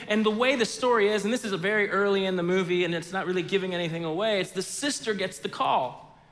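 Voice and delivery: ordinary talk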